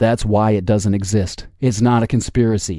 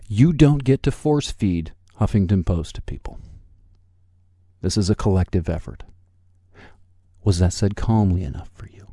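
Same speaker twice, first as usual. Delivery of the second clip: usual speaking voice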